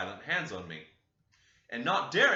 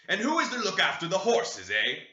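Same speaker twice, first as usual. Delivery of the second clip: loud, raised and scolding; higher, louder rebuke; angry master; 7 semitones higher, 7 dB louder than usual. A narrator's reading. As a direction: demand it with contempt. Master berates about the horses.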